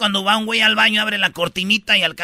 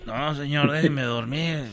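sleepy man; 8 semitones lower, 9 dB quieter, slower than usual. Acted. Sleepy passenger woken up.

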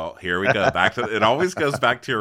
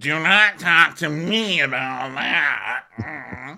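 slow mock haughty; 6 semitones higher, 4 dB louder, much slower than usual. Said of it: "Meredith is like".

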